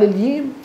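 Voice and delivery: high, quick الييم with y for j; jim as ya. Retold or imitated. Questioner insists to the teacher, jim as ya. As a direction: pitch الييم up, keeping the y glide. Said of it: "قال".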